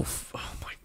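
whispered, barely audible disbelief; exasperated whisper. Real, not acted. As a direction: breathe it out in disbelief. Outrage at sweet tea insult.

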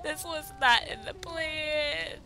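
high, crying and shouting with joy; teary squeal. Real, not acted. Overwhelmed by the couple, high teary squeal. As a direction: let the happy tears break through.

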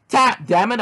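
high, forceful yelled order; yelling. A narrator's reading. Hero has Cleric in an ankle lock, yelling at him. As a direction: yell it mid-struggle. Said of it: "I yelled".

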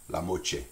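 "la moche" said la mo-chay; Louisiana French CH. Shown as a demonstration. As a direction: say "moche" with an English CH and final AY. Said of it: "T I in the middle as a C H".

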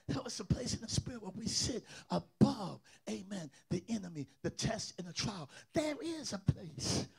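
soft, choked-up sermon, near tears; tearful quiet preaching. Real, not acted. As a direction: pour it out gently, on the edge of crying.

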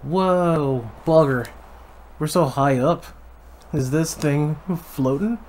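put-on butchered Irish accent. Player voicing the hero in a bad Irish accent. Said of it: "the most butchered Irish accent".

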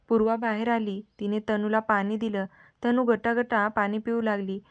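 usual speaking voice

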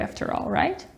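breathy secret whisper. Shown as a demonstration. Teacher whispering a secret to students. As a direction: keep it hushed so nobody hears. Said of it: "I'm going to whisper".

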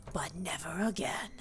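hushed whispered vow. Real, not acted. Whispered vow after Team Rocket joke.

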